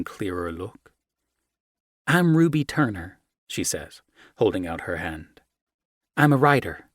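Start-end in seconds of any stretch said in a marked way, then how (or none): 2.1-3.2 higher, bright introduction; young American woman. Acted. Ruby introducing herself to Luciano, higher and bright. 6.2-6.9 higher, bright introduction; young American woman. Acted. Ruby introducing herself to Luciano, higher and bright.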